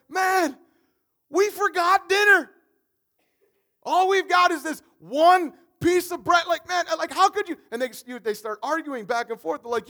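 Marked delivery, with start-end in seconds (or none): 0.1-7.6 high, loud dismay over the missing dinner; panicked disciples. Acted. Disciples in the boat, loudly panicking over bread. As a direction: throw up your hands, we forgot the food.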